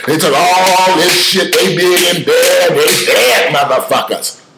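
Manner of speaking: mock tough guy. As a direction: puff up like a fake tough guy. Mocking tough-talking online warriors.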